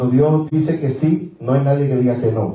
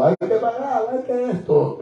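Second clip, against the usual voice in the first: high, pleading voice of a distressed wife; desperate tearful wife; 9 semitones higher than usual. Retold or imitated. Husband retells wife's panic over unpaid bills, high and tearful. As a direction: imitate her panicked, weepy pleading. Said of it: "Tita estaba desesperada, llorando".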